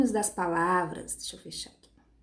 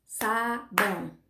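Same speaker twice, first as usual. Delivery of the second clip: slow, evenly beaten syllables with gaps; "Sabão" as sa-bão, "Cafuné" as ca-fu-né, one beat each; clapped-out syllables; much slower than usual. Shown as a demonstration. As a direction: chop each word slowly to the beat of claps.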